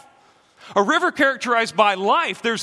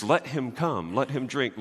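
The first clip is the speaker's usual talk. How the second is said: gentle, softer, an optional offer; soft invitation; 7 semitones lower, 6 dB quieter than usual. Shown as a demonstration. Pastor showing the congregation the soft English rendering, gently. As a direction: read it as a mild invitation, easing off the volume. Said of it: "The way that the English translations put it is".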